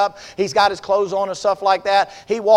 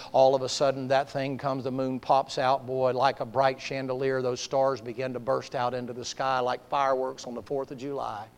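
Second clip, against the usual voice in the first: low, softened preacher's narration; hushed sermon storytelling; 6 semitones lower, 9 dB quieter than usual. Acted. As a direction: draw listeners into the scene quietly. Preacher narrates the calm softly.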